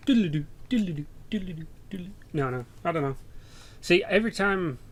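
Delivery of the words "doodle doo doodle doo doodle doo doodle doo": repeated doodle-doo dream-transition trill, fading away; Wayne's World flashback noise. Retold or imitated. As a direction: imitate a wobbly flashback sound effect. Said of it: "Wayne's World thing".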